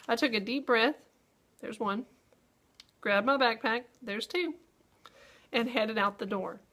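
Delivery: long pauses after breath and backpack; pauses at commas. Shown as a demonstration. Teacher showing students where her voice pauses, with long breaks. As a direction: pause clearly where each comma goes. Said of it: "I heard my voice pause there a few times".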